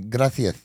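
"gracias" with a th for the c; Castilian th sound in "gracias"; Spanish lisp. Shown as a demonstration. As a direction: lisp "gracias" as "GRA-thee-as". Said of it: "In Spain, they have a lisp".

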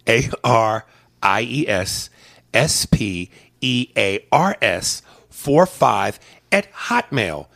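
letter by letter, patient; slow spelling for the slow; spelled out slowly. Shown as a demonstration.

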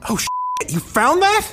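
hushed then high, caught red-handed; whispered panic, then squeaky surprise; caught-out company. Pretend. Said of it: "the only thing they could actually say would be".

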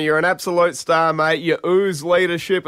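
ordinary talk

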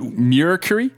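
Mercury as "MYUR-kuh-ree", rising questioningly; mispronounced attempt. Real, not acted. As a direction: misplace the glide in Mercury, unsure lift at the end.

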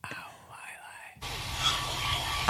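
extremely slow, faint whisper; soft, stretched EVP imitation; ghostly whisper. Guessing what ghost whispered.

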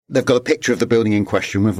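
ordinary delivery

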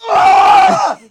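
high, loud yell of rage; frustrated scream. Retold or imitated. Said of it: "in the car going".

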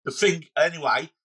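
Cockney politician losing his thread; Cockney accent. Acted. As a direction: bumble and waffle in a Cockney accent. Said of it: "imagine that in a Cockney accent".

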